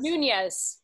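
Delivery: NUNEZ as "noon-yez", falling surely; name pronounced. Shown as a demonstration. Guest teaching the host her surname, Nunez pronounced "noon-yez". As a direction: say Nunez with a y glide after the second n. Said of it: "how do you pronounce your last name?".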